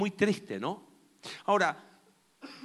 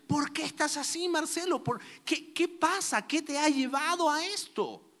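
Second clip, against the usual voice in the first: higher, pleading voice asking himself why; a man asking his own soul what is wrong, higher and pleading; searching self-question; 8 semitones higher than usual. Pretend. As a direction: probe yourself gently but urgently, pitched up. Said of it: "Él hace una pregunta profunda e incómoda".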